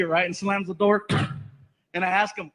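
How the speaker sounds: usual speaking voice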